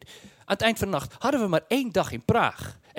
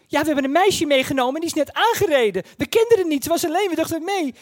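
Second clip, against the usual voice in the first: put-on higher, worried girl; young girl voice; 7 semitones higher than usual. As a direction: pitch it up like a teenage girl. Girl reporting injured friend.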